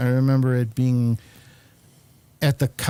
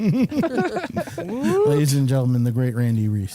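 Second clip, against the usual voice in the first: announcer intro. Acted. Host presents the guest like a ringmaster. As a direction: introduce him like a big act.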